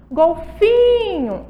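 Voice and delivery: "Golfinho" with FI held long and high; exaggerated slow stress on FI in "Golfinho"; stressed syllable drawn out. Shown as a demonstration. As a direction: call out "Golfinho", stretching the FI. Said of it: "a sílaba tônica é o fi".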